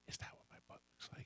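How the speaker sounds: hushed, near-whispered imitation of wife; sincere, imitated wife. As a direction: ask it very softly, in earnest. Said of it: "in all sincerity, she says".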